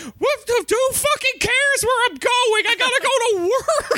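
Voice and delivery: high squeaky Mickey voice. Acted. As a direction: squeak it out as a cartoon mouse. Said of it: "She's like".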